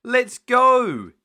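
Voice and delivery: high, loud cheer. Acted. Peppa cheering in the car, loud and high. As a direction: cheer as you set off. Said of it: "cheered Peppa".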